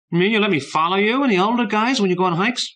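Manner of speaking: put-on high, loud, eager kid's voice; eager little brother. A narrator's reading. Little brother hoping to join the hikes, high and eager. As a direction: bounce it out like an excited kid.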